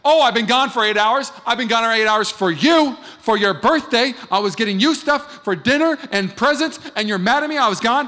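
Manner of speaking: wife escalating against her sulking husband; getting bigger. Retold or imitated. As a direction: push back hard, defending herself. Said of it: "She gets bigger".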